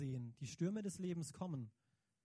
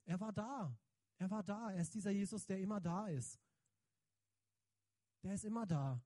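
normal talking voice

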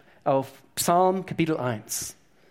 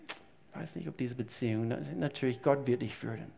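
soft polite refusal; 3 semitones lower, 9 dB quieter than usual. Pretend. Imagined polite refusal to friend.

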